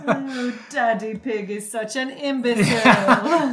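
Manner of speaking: loud put-on British cartoon voice; hammed-up mock-British kiddie voice; Peppa Pig. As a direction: imitate a cartoon piglet, amused. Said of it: "Peppa Pig voices".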